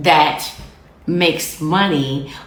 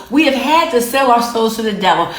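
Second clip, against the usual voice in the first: ordinary delivery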